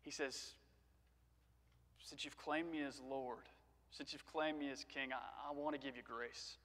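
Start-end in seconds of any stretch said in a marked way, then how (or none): 2.0-6.6 hushed, measured reassurance; tender divine promise. Acted. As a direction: speak gently, as God granting grace. Tender divine answer, much softer.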